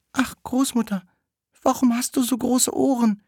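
put-on high-pitched little-girl voice; Rotkäppchen questioning. Rotkäppchen to the disguised wolf in bed, high questioning voice. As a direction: ask in a high, put-on child voice. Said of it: "rief das Rotkäppchen".

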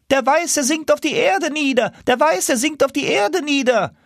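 high, alarmed goose voice; high goose call. Acted. Rear geese warning that the gander sinks, high call. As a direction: warn the leader he is falling.

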